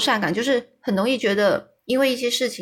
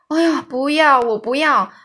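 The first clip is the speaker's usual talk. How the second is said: frightened protest; 5 semitones higher, 6 dB louder than usual. A narrator's reading. Sophie fears being eaten.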